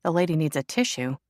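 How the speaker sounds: plain reading voice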